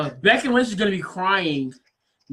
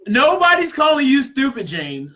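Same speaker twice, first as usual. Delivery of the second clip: heated retort; 5 semitones higher, 5 dB louder than usual. Real, not acted. Arguing with James.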